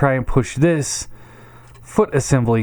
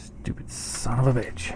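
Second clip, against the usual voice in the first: muttered curse; 9 dB quieter than usual. Real, not acted. Frustrated with stuck piece.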